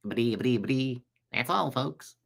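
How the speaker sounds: understated imitation of a stuttering cartoon character. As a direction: stammer a cartoon farewell under your breath. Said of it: "Mel Blanc did all those voices".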